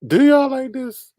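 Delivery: high, slow incredulous squeal; mock disbelief. Streamer mocking the copied design, high drawn-out squeal. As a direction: drag it out in sarcastic disbelief.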